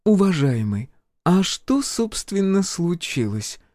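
high-pitched, curious healer. A narrator's reading. Healer asks the major what happened, curious. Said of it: "С любопытством спросила Надежда Владимировна".

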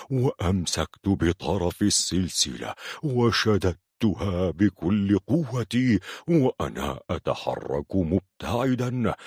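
usual speaking voice

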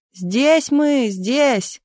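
high, mild yell; yelling back. A narrator's reading. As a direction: call back so you get found. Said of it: "заорал Миша".

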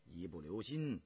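low, soft counsel; cautioning character. Acted. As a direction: voice a grave warning, kept low and quiet. Voiced character in story narration.

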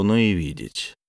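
ordinary delivery

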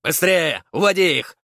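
loud high barked command; barked order. A narrator's reading. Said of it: "рявкнул сорокалетний".

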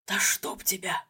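hushed frightened gasp of a curse; frightened exclamation. A narrator's reading. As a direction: blurt it out in a scared whisper. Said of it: "испуганный возглас вирусолога".

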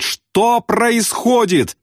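very high, long-stretched cry; wailing yell. A narrator's reading. Airman who cannot hear himself, high wailing yell. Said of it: "и он завопил".

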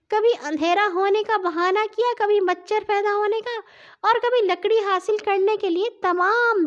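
very high, thin complaining voice; aggrieved talking axe. A narrator's reading. Talking axe recounts its misuse to the children, aggrieved high voice. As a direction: pour out grievances in a high little voice. Axe figure tells its grievance.

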